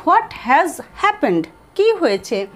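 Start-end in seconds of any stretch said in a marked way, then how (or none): 0.1-1.4 stretched out word by word; very slow. Shown as a demonstration. Teacher modelling the longer question slowly. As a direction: drawl it out for learners. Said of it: "বা বলতে পারি".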